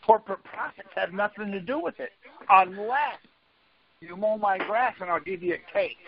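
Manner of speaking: normal talking voice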